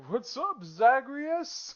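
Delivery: high-pitched, laid-back Olympian uncle; [name]. Acted. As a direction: play a sea god as a high-voiced rapper parody. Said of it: "He's like [name], but, like, Olympian version".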